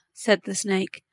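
ordinary delivery

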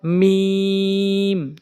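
"মিম" held long and level, closed on the lips; lips-together articulation. Shown as a demonstration. Teacher shows learners the Arabic letter, lips-together long "মিম". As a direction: stretch "মিম", pressing the dry lips together. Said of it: "দুই ঠোঁটের শুকনো অংশ মিলিয়ে".

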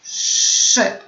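"szy" with a long hushing sz before the vowel; drawn-out SZ. Shown as a demonstration. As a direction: model the syllable "szy", sz held long. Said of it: "Sylaby poćwiczone".

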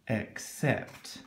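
put-on a conspiratorial whisper to the child; whispered aside. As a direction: whisper it, secretive.

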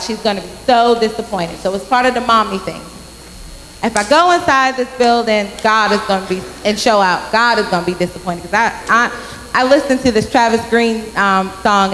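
ordinary talk

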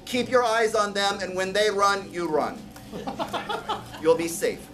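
usual speaking voice

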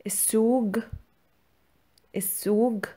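السوق said as "is-soog" with a hard g; hard g pronunciation. Shown as a demonstration. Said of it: "This can also be pronounced as".

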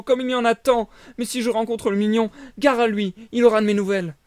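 high, loud repentant puppet; regretful puppet boy. Acted. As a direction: wail regrets then warn. Puppet laments, threatens. (voix de pantin plaintive)

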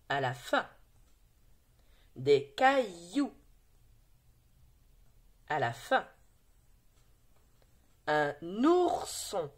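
patient teacher reading out words. Shown as a demonstration. Teacher reads words to pupils.

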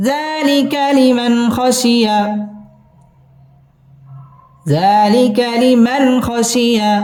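slow, lightly chanted phrase; modelled recitation. Shown as a demonstration. Teacher showing students the joined words, slow chant. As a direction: stretch it out as a model for learners. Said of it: "teacher models the joined phrase".

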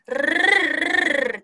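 "ルルルルルルル" as one long rolled r; voiced trill. Shown as a demonstration. Teacher showing voiced consonants, "ルルルルルルル" as a sustained trill. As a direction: roll the tongue through "ルルルルルルル" with voice. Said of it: "音が、 あのー、つく".

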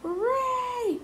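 put-on long high cheer from a crowd of kids. A narrator's reading. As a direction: cheer loud and high like happy children. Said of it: "cried the children".

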